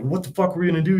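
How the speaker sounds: high, agitated demand; a frantic, angry question; irate panic. Retold or imitated. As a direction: partner panicking over the stuck Jeep, irate. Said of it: "he's getting all irate and upset".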